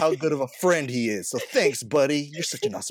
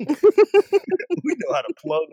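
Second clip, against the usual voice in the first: laughing; 14 semitones higher, 9 dB louder, much slower than usual. Real, not acted. Laughing at own plug joke.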